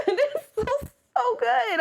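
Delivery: high laughing squeal. Real, not acted. Laughing at the villain scene.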